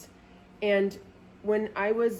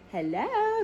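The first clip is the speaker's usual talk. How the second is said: much higher, sung-out greeting; singsong hello; 14 semitones higher than usual. Retold or imitated. Calling the dog boarder, high singsong hello. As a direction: chirp it like a cheery phone hello.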